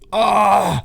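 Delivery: high loud cry of amazement; excited crowd cry. Retold or imitated. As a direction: yelp it like a stunned crowd. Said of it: "And everyone's like".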